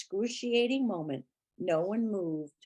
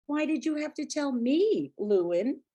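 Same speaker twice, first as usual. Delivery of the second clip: cruel; 6 semitones higher, 3 dB louder than usual. A narrator's reading. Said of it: "Harrison said cruelly".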